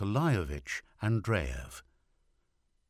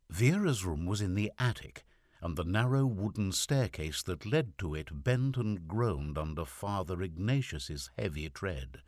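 ordinary delivery, nothing marked

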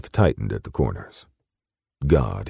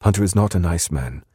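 higher British woman's voice; British accent, pitched up; 6 semitones higher than usual. A narrator's reading. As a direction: warn him in a British accent. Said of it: "in her soft British accent".

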